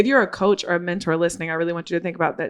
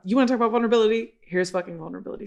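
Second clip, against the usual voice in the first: fast inner rant; 3 semitones higher, much faster than usual. Retold or imitated. Retold inner thought, fast.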